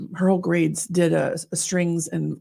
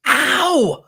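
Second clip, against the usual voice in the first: sharp high retold yelp of pain; mock ow; 8 semitones higher, 5 dB louder than usual. Mom telling about daughter's knee, yelping high. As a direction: yelp like a bumped knee hurts. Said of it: "And I was like".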